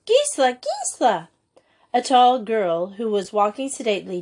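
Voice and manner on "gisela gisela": high excited cry of a name, easing on the repeat; shriek. A narrator's reading. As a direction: call out to a friend in surprise. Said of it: "uttered a shriek".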